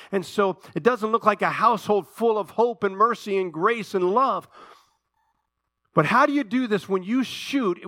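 high, loud, driving preacher's cry; full-force pulpit preaching, pitch and volume way up; fiery preaching. Acted. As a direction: preach it hard to the whole room.

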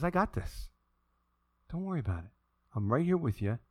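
soft, hushed comforting voice; calm reassurance. Acted. God comforts someone whose world is collapsing, soft and calm. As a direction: reassure quietly, completely unworried.

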